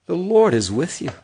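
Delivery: ordinary talk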